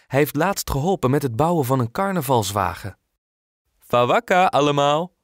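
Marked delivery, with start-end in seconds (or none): 3.8-5.1 louder, lightly voiced greeting to all; cheerful call. Painter greeting the neighbours from his van, cheerful louder call. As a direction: call out a hearty hello. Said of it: "roept meneer Nelson".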